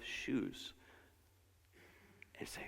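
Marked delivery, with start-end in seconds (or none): none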